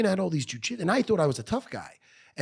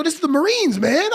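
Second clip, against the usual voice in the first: much higher, louder protest; indignant protest; 13 semitones higher, 8 dB louder than usual. Retold or imitated. Protesting to the recruiter, higher and louder in indignation. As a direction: argue back at the recruiter, stunned. Outraged at Marines rejection.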